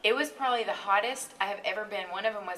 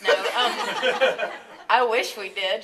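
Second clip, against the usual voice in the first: louder, laughing mock boast; laughing admission after a sarcastic brag; laughing joke; 3 semitones higher, 10 dB louder, much slower than usual. Real, not acted. Joking about being best friends.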